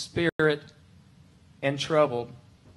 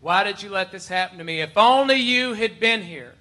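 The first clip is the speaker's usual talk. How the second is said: louder, rising to a higher cry; reproachful plea; 4 semitones higher, 7 dB louder than usual. Acted. Preacher voices sufferers questioning God, reproach building louder. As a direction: build from complaint to loud reproach. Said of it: "And we could say".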